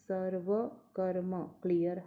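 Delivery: normal talking voice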